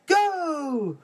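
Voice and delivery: high loud start call; shouting go. Acted.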